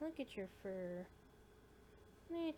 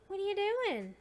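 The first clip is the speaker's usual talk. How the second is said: cooing at a kitten; 10 semitones higher, 8 dB louder than usual. Real, not acted.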